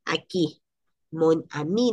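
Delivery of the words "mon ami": "mon ami" unido sin pausa, la n pasa a la a; mon ami ligado. Shown as a demonstration. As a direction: liga "mon" con "ami" en un solo golpe. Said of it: "no es qui, mon pausa, ami, sino mon ami". (enlace francés en "mon ami")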